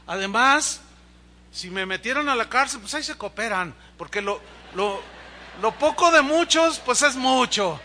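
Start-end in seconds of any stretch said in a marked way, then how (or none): 5.6-7.8 joking, laughing. Real, not acted. Joking about bail collection.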